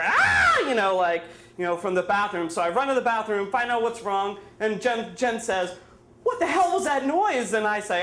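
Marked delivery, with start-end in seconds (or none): 0.0-0.6 loud high mock scream; bloody-murder scream, imitated. Storyteller imitates Jen heard from the bathroom, screaming bloody murder. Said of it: "Jen screamed bloody murder". 6.2-7.5 high-pitched startled question; alarmed demand, imitated. Storyteller plays Jen after the crash, startled and high.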